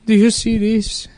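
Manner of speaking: put-on high, slow Mexican-accented voice; mock tearful. Landlord pointing out painting in trash, mock-tearful Mexican accent. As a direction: imitate a wistful landlord on the verge of tears. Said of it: "he's, like, tearing up".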